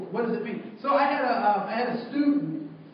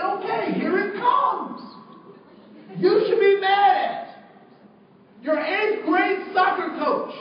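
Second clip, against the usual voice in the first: dramatic reveal; 8 semitones higher, 4 dB louder, slower than usual. Retold or imitated. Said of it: "And I said".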